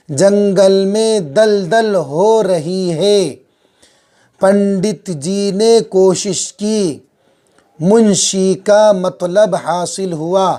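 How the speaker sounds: sentences read slowly and clearly; slow, careful classroom reading; slow model reading. Shown as a demonstration. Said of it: "आठ दस मरतबा पढ़ें".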